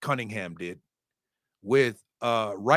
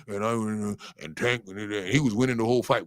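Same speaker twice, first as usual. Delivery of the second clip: garbled, lower mumble of a complainer; mocking the haters; 3 semitones lower than usual. Boxing fan mimics haters defending the loser, mocking mumble. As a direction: imitate haters grumbling half-words. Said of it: "And for all you haters out there".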